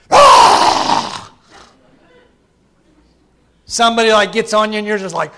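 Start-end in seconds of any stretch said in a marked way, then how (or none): 0.1-1.3 sudden high, unvoiced shriek of frustration; mock scream. Shown as a demonstration. Preacher showing the congregation his angry scream, a sudden high shriek. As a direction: let out a quick breathy scream, half joking. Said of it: "that little thing on your shoulder that helps you scream".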